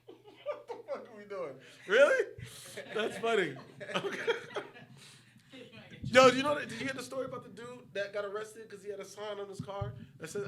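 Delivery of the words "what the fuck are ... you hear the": laughing. Real, not acted. Cracking up at his friend.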